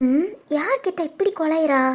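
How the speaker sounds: softly suspicious; hushed, disapproving undertone; muttered aside. Acted. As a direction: grumble to yourself, unheard by the caller. Eavesdropping mother's aside on the call.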